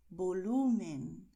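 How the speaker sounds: volumen, slow with a strong LU; penultimate stress. Shown as a demonstration. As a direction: stretch and punch LU in volumen. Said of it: "acentuamos la penúltima sílaba".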